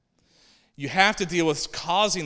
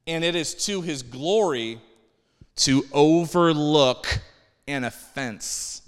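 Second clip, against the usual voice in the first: emphatic scripture reading; 6 dB louder, slower than usual. Acted.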